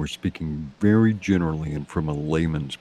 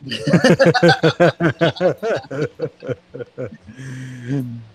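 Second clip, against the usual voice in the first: loud amused laughing fit; long hearty laugh; 6 semitones higher, 4 dB louder than usual. Real, not acted. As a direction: burst out laughing at the joke. Amused by fisticuffs answer.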